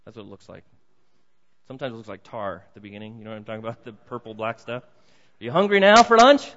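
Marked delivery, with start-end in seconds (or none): 4.8-6.5 put-on much higher, louder cooing question to a baby; sing-song baby talk. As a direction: call out to the baby like a playful grandparent.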